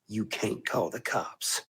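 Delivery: very soft, low, slow challenge; low, knowing murmur of a dare; hushed taunt. Real, not acted. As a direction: sink almost to nothing, taking your time. Repeats the taunt more softly.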